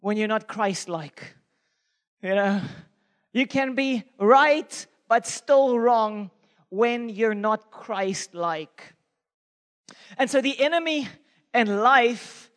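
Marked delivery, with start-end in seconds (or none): 3.3-8.9 slow, drawn-out repeat of the point; emphatic slow repeat. Real, not acted. Preacher repeating his point to the church, slowly. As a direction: drive the lesson home slowly. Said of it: "repeated sermon point slower".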